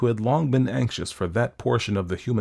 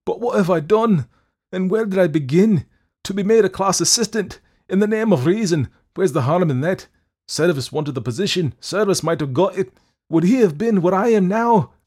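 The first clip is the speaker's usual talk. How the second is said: high, loud, desperate protest; anguished crying out; 11 semitones higher, 7 dB louder than usual. A narrator's reading. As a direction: wail in dismay at your fate. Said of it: "he cried".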